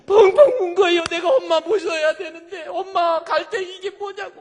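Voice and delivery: high, loud wailing lament; imitated loud sobbing. Eldest daughter at her mother's funeral, wailing through tears. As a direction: act out a daughter bawling at a funeral. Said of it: "막 울면서".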